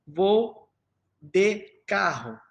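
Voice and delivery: DE with a full, closed E; word by word, DE sounded as spelled; as written. Shown as a demonstration. Teacher reads the example as it is written, DE with a clear E. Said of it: "nós escrevemos assim".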